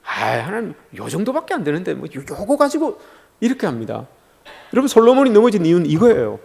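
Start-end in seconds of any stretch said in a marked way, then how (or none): 0.0-3.0 small, much softer excuse; minimizing a wrong. Acted. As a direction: shrink it to nothing, pleading quietly with God. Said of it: "내가 뭔가를 잘못했을 때는".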